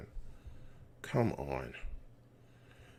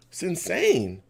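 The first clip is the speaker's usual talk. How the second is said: normal talking voice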